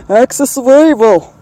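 "Axis of evil" booming in a mock grand voice; hammed-up ominous announcer; mock dramatic. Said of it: "dramatic pun".

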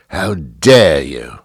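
slow, mock-outraged British accent; huffy, hammed-up British accent; indignant British accent. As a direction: draw it out in a put-on British accent. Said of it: "we just jump right into the accent".

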